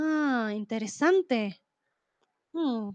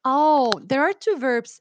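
normal talking voice

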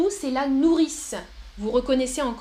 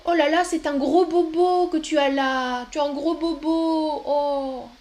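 higher cooing over a big boo-boo; baby talk to a child; 3 semitones higher, 3 dB louder than usual. Shown as a demonstration. Teacher fussing over a child's boo-boo, cooing. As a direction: fuss over the child's scrape.

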